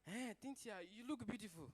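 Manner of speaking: softly voiced, timid compliment; shy suitor. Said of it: "I'm very shy".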